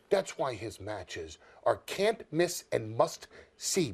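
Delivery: usual speaking voice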